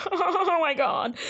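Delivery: high, slow laughing exclamation. Real, not acted. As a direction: crack up, then squeak out the words. Cracking up at her own joke.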